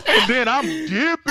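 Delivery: bursting into high laughter; laughing. Real, not acted. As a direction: laugh through the words, loud and high. Laughing while telling escape.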